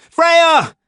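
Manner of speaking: very high, loud call; desperate warning shout. A narrator's reading. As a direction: yell her name as loud as you can. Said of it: "I shouted as loudly as I could".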